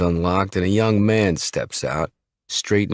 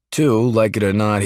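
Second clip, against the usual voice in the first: ordinary delivery, nothing marked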